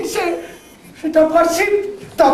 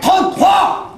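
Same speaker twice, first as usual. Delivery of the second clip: shouted back louder; 4 semitones higher, much slower than usual. Acted. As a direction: belt it out louder. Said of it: "Euh, plus fort". (plus fort, crié)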